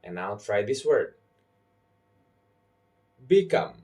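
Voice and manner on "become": "Become" with COME stressed; stress on second syllable. Shown as a demonstration. Teacher giving learners a word to try, second syllable stressed. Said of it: "I'm stressing the second syllable".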